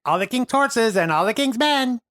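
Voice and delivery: high, loud rhyme-reciting voice; Mago. Acted. Game master voices the riddle at the rubble, high proclamation. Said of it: "you hear the Mago say".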